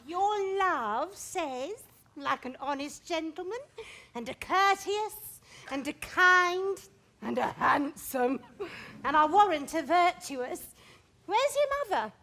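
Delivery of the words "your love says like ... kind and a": drawn-out, dawdling words; teasingly slow. Acted. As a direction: stretch every word to tease. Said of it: "How oddly thou repliest".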